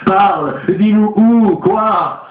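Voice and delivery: high, slow pleading questions; coaxing medium. A narrator's reading. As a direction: coax the spirit to speak. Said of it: "disait engageante Madame Olcott".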